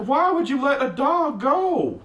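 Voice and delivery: high, indignant squeal of disbelief; outraged aside. Real, not acted. Reader scolding the shelter for losing a dog, high outraged squeal. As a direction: break off the quote in mock outrage.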